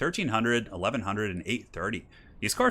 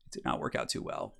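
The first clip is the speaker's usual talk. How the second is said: dry whispered repeat under breath; whispered aside; 10 semitones lower, 10 dB quieter than usual. Real, not acted. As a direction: mutter it to yourself, deadpan. Muttered repeat of failed move.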